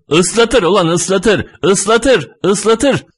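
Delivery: high, insistent repeated yell; defiant high-pitched shouting; full-throated shouting. A narrator's reading. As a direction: shout each one out high, falling at the end. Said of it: "Ağzım çıktığı kadar bağırdım".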